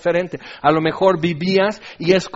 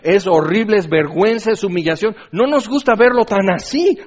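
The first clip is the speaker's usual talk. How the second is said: loud, raised preacher's voice; urgent, driving sermon delivery; forceful preaching; 3 semitones higher, 5 dB louder than usual. Real, not acted. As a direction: pound out each consequence emphatically.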